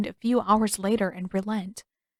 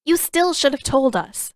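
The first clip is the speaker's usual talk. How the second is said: higher, louder stern scolding; firm reproach; 6 semitones higher, 6 dB louder than usual. Acted. Katara glaring at Aang, firm higher reproach. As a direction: scold him firmly. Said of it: "Fixing her face into a firmer glare".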